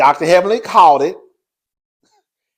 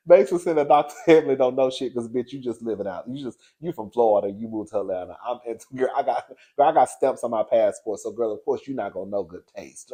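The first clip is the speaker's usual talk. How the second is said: mock snooty read; 5 semitones lower, 6 dB quieter than usual.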